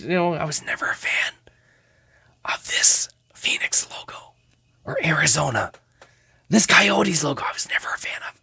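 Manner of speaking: hushed whisper about the logo; whispered aside. Real, not acted. Card collector whispering his dislike to viewers. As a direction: drop to a whisper, confiding.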